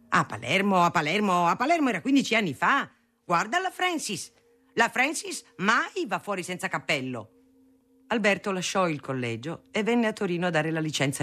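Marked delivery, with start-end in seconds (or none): 0.1-2.9 mocking retort. A narrator's reading. Father mocks mother's Palermo argument. 3.2-7.3 high-pitched indignant protest; exasperated retort. A narrator's reading. Father holding up Francis to his wife, exasperated.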